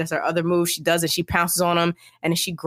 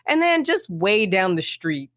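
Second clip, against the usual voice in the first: usual speaking voice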